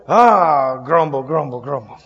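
low grumble sinking away; mock grumbling. Slapping the alarm clock off in the morning, mock grumbling. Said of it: "slap it and go".

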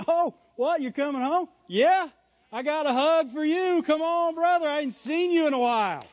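high, delighted homecoming greeting; excited welcome. Acted. Church member greeting a brother coming home, excited welcome.

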